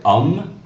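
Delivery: homme said "um", no H; silent H. Shown as a demonstration. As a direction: say homme starting on the vowel. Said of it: "silent in French".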